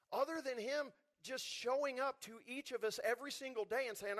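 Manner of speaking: ordinary talk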